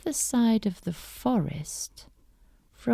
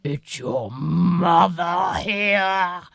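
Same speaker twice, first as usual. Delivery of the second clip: rough wolf voice; 13 dB louder, slower than usual. Acted. Wolf voice at door.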